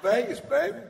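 high, loud triumphant yell; gloating shout. Acted. Boasting to the crowd about Vegas, loud gloating yell. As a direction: crow it like a Vegas boss.

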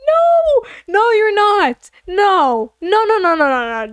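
high, drawn-out cry of refusal; protesting. Real, not acted. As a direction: refuse it in high-pitched disbelief. Mock horror at Jojo's claim.

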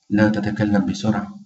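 normal talking voice

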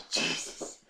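awed breathless whisper. Real, not acted. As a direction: breathe it out in awe. Stunned after the opening ends.

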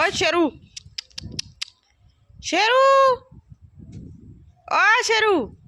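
loud high call for the dog. Real, not acted. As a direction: shout the dog's name across the yard. Calling the dog from afar. (ਉੱਚੀ ਆਵਾਜ਼ 'ਚ ਹਾਕ ਮਾਰਨਾ)

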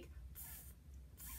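"Th" as an isolated soft friction sound; blended letter sound. Shown as a demonstration. Teacher showing T and H together, isolated sound. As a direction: hold "Th" alone, no vowel after it. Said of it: "together they make".

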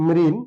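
মৃন as "mrin", stopped on the n; one closed syllable মৃন, then halt; correct first syllable. Shown as a demonstration. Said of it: "সঠিক উচ্চারণ". (থেমে যাওয়া বদ্ধাক্ষর "মৃন্")

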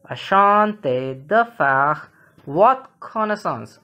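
slow, spaced-out French for learners; measured teacher's repeat, drawn out; slow model reading. Shown as a demonstration. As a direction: say it slowly, phrase by phrase. Teacher repeats phrase slowly for learners.

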